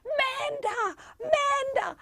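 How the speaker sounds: put-on high, excited little-child cry; two-year-old nephew. Toddler nephew running to his aunt, high delighted shout. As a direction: squeal the name like a toddler running over. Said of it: "hurtle towards me with his arms stretched out".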